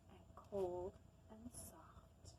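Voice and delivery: slow hushed whisper; soft slow storybook whisper. Acted. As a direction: breathe the words out slowly and softly.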